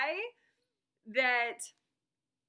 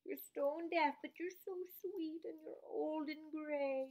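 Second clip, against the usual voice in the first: soft, higher cooing; affectionate pet talk; 5 semitones higher, 8 dB quieter than usual. Real, not acted. Cooing to her old deaf pet, affectionate. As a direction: coo softly and sweetly.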